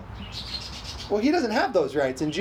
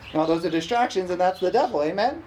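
ordinary talk, nothing marked

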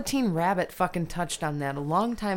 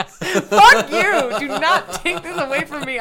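much higher and louder, laughing outrage; laughing protest; 8 semitones higher, 9 dB louder, slower than usual. Real, not acted. Nick ruins her favorite book, laughing outburst.

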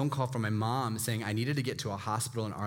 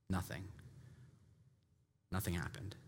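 hushed; 6 semitones lower, 12 dB quieter than usual. Real, not acted. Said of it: "dramatic pause on nothing".

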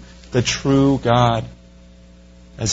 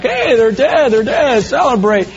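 gloating cheer; 10 semitones higher than usual. Retold or imitated. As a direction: cheer like a mocking crowd celebrating. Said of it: "It's like".

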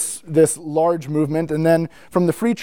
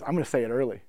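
low, soft confiding undertone; conspiratorial aside; 5 semitones lower, 9 dB quieter, faster than usual. Retold or imitated. Friend confides his plan to the speaker, conspiratorial aside. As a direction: drop it low, like letting someone in on a prank.

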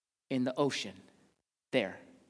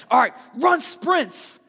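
coach; 8 semitones higher, 8 dB louder, slower than usual. Retold or imitated. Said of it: "he's like".

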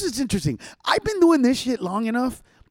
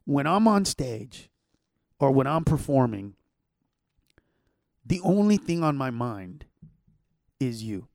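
sincere; 6 semitones lower, 4 dB quieter than usual. Real, not acted. Sincere heartfelt point about serving.

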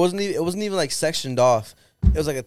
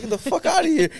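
1 mock outraged; 4 dB louder than usual. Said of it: "I was like".

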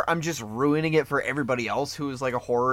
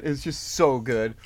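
ordinary delivery

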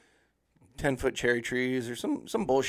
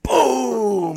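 big, high, loud "boom"; drawn-out falling-tree boom, shouted; booming crash imitation; 10 semitones higher, 9 dB louder than usual. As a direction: make the tree crash with your voice.

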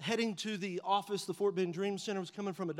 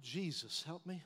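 hushed, desperate plea; softly begging Jesus; quiet prayer; 5 semitones lower, 10 dB quieter than usual. Retold or imitated. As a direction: breathe it out to God. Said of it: "I'm like".

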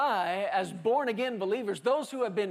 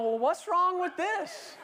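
higher, slow, wounded husband voice; mock whiny protest; 8 semitones higher than usual. Husband protests to his wife, higher and slow with a laugh. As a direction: play the puzzled husband pleading innocence.

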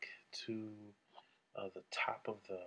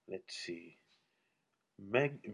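ordinary talk, nothing marked

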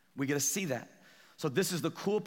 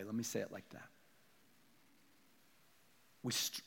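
low, soft reflective confession; quiet pulpit reflection with pauses; subdued preaching; 5 semitones lower, 4 dB quieter than usual. Real, not acted. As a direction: drop down and admit it humbly.

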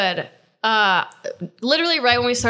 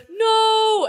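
retold protest; 11 semitones higher than usual. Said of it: "Katie was like".

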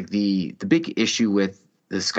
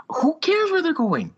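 exasperated. Retold or imitated. Dad snapping at his kid.

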